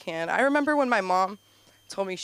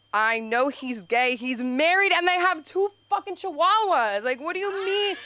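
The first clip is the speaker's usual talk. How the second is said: exasperated retort; 8 semitones higher, 6 dB louder than usual. Retold or imitated. Retold exasperated reply.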